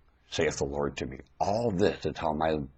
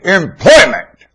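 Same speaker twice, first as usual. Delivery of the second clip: emphatic word; 13 semitones higher, 16 dB louder, slower than usual. Real, not acted. Preacher repeating key word.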